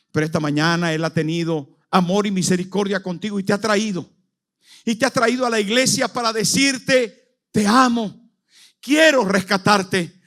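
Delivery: high, loud impassioned sermon voice; preaching at full force. Acted. Preacher calling the congregation to repent, full force. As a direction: preach it with everything you have.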